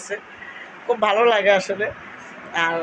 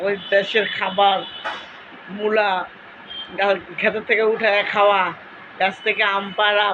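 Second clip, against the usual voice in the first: ordinary talk, nothing marked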